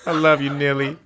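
high, loud, giggly mock tenderness; laughing tease. Real, not acted. Calling out to crying Neil, high laughing mock affection. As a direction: coo at him while laughing. Teasing crying friend off-camera.

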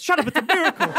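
the priest shutting down a doubting rat; laughing insistence. Acted. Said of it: "She goes".

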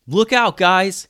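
high, loud warning shout; yelling. A narrator's reading. Alex warns friends before rolling a pirate downstairs, yelling. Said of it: "yelled Alex".

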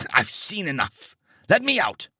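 high, loud desperate plea; choked-out panic. A narrator's reading. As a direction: beg to escape in horror. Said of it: "I choked out".

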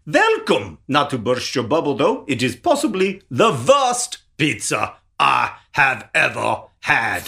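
hammed-up Austrian accent, dragging to a close. Shown as a demonstration. Podcaster pans the pizza for cohost, mock Austrian accent. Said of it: "This is my Austrian".

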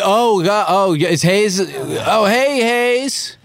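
ordinary talk, nothing marked

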